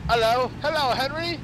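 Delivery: high put-on lady's greeting. Voicing the plane's co-pilot as a lady, high and dainty. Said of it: "My co-pilot talks like a lady".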